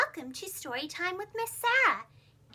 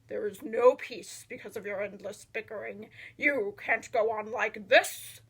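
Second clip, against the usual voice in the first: large toad; 7 semitones lower than usual. Acted. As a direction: speak low as the old toad. Large toad scolds the frogs.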